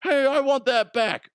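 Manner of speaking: high, loud whiny demand; mock France demanding. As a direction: whine like a petulant child. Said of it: "classic France doing France things".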